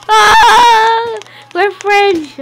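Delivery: loud, high pleading; frantic loud plea; panicked yell. Real, not acted. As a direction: shout to stop the attack. Pleading with attacking player.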